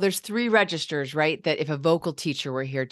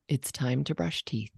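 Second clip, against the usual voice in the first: lower, softer and brief; calm brief version; 3 semitones lower, 5 dB quieter than usual. Shown as a demonstration. Parent calmly announcing teeth time, low and brief. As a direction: say it short and grounded. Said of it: "listen to these two versions".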